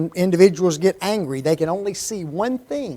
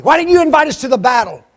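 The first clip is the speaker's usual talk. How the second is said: loud, high, indignant demand from the tribe; the men of Ephraim confronting Gideon, loud and aggrieved; angry challenge; 7 semitones higher, 7 dB louder than usual. Acted. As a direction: hurl the questions as an offended kinsman. Angry Ephraimites voiced in sermon.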